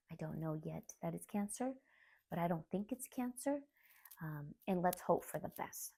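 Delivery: softly retold doctor's answer; hushed reassurance. Retelling doctor's careful answer.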